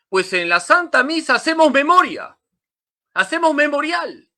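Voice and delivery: fervent pulpit climax; climactic preaching. Real, not acted. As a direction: cry it out to the congregation.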